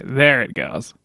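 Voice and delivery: ordinary delivery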